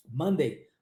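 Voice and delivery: "Monday" said as "mandei", open a. Shown as a demonstration. Teacher modelling Monday for students. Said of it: "Acontece também na palavra Monday".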